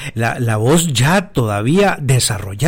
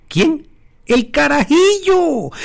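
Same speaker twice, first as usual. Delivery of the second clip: put-on much higher, cracking boy's voice; voz de muchacho; slower than usual. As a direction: squeak it out like a teen whose voice is breaking. Describing young David's breaking voice. (voz aguda de adolescente que se quiebra)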